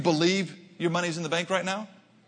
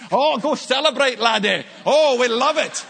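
high, loud, thrilled Scottish cheer; imitating a Scottish homeowner's loud, gleeful send-off; excited Scotsman; 8 semitones higher, 7 dB louder than usual. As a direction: gush like a delighted local urging him to celebrate. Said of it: "he was all excited".

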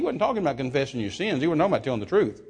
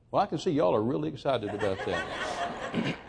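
teasing laugh; 2 semitones lower, 6 dB quieter than usual. Real, not acted. Teasing a quiet congregation.

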